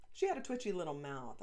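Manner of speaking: usual speaking voice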